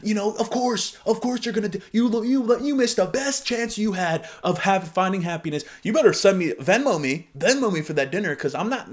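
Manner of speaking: hammed-up whiny resentful voice; mock bitter guy. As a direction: ham up the entitled rejected date. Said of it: "I wasn't like".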